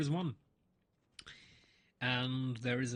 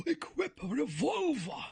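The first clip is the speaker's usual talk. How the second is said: high, slow, thrilled exclamation; excited gasp; 11 semitones higher than usual. Real, not acted. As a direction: gasp and savour the new gun. Finds revolver in game.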